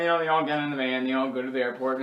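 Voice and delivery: usual speaking voice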